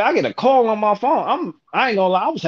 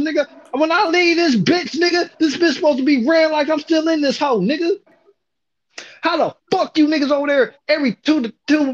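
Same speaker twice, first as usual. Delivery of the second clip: furious yelling; 7 semitones higher than usual. Retold or imitated. Boss yelling at the two workers for stealing, angry. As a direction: yell at the young workers. Retold chewing out of coworkers.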